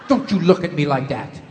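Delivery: an exasperated mother ranting; mother lecturing her son, mock angry; mother's tirade. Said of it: "queen of lectures".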